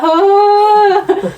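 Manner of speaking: high, loud sung-out cry; imitating a locked-in woman's wail; stuck wail. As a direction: wail like someone high and trapped. Trapped junkie wailing in toilet.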